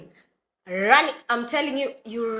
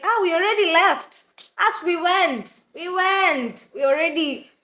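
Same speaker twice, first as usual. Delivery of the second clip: imitating mom; 9 semitones higher, 4 dB louder than usual. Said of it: "And she's like".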